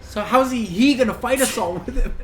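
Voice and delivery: ordinary talk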